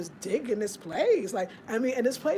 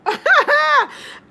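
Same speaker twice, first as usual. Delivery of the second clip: loud high laugh; 13 dB louder than usual. Real, not acted. Laughs at herself.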